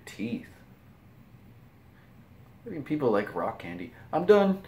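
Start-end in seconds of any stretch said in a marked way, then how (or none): 4.1-4.6 "I'm done" high and loud, bright exclamation; excited exclamation. Real, not acted. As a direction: toss it out cheerfully, done and happy. Exclaimed cheerful sign-off.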